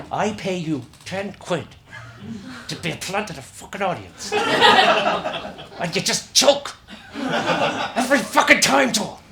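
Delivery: scolding through shut teeth; talking through teeth. Acted. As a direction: talk through clenched teeth. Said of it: "Can't hear me when I talk through my teeth".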